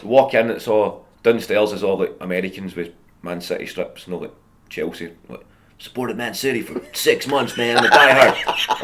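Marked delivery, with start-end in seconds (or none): 5.8-8.8 mock American fan. Mocking American fans in pub.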